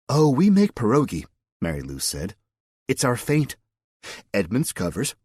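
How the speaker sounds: a hint of the eager church lady; lightly voiced Mary Lou. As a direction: reveal the secret with lively conviction.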